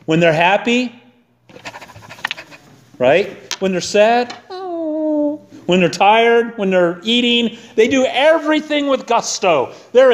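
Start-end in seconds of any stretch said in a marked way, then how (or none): 4.5-5.4 high, soft imitated dog whine; long high mock whimper; sad dog whimper.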